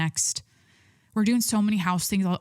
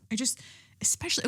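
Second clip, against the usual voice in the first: whisper; 4 semitones higher, 4 dB quieter, faster than usual. Real, not acted. Sudden aside.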